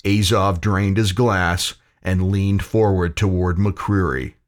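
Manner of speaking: usual speaking voice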